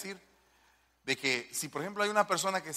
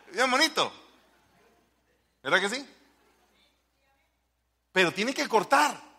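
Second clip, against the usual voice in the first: ordinary talk, nothing marked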